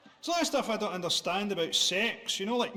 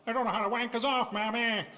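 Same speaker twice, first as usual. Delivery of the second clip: put-on old minstrel-show crooner, higher pitched; 4 semitones higher than usual. Comedian mocks his own line, crooning vaudeville impression. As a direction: belt it like a vaudeville number. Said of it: "turned that into an [name] number".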